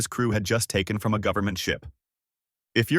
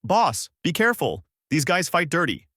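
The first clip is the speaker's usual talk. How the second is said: yelled; 7 semitones higher than usual. A narrator's reading. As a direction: shout a warning to the boss. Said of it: "A bandit yelled out".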